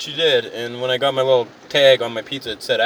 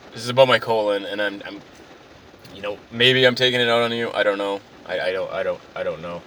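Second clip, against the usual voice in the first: low, halting, deflated confession; acted subdued self-pity with broken-off starts; sheepish apology; 4 semitones lower than usual. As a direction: back down and falter through an excuse. Customer breaks down apologising, acted.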